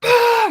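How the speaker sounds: explosive high, loud "bah" screech; sudden scream. Retold or imitated. Showing her go from calm to screaming in your face, sudden scream. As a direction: burst out with one screamed syllable. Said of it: "She would start screaming".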